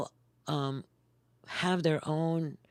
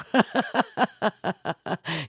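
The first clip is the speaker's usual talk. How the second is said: loud laugh; 5 semitones higher, 6 dB louder than usual. Real, not acted. Big laugh after emphatic point.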